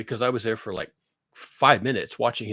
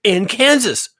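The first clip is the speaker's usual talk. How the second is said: incredulous outburst; 4 semitones higher, 6 dB louder than usual. Retold or imitated. Looking at the radar image, shouting in disbelief at Kansas. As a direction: blurt it out at the radar. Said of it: "I'm saying".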